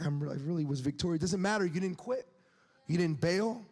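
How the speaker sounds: ordinary talk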